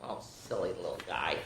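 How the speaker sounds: usual speaking voice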